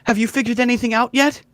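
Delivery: high, stammering reassurance; flustered townswoman. Acted. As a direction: recover from a fall, hurriedly waving it off. GM voices flustered Treese after falling.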